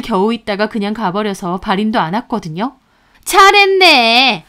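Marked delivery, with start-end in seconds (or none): 3.2-4.4 slow, higher, biting "well done"; sarcastic praise. Acted. Mother-in-law mocking daughter-in-law's answer, slow and sarcastic. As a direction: drag it out with scorn.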